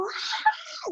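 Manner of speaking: high mock scream of release; imitating her big scream; retold scream. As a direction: let out a sudden high cry. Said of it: "grité como".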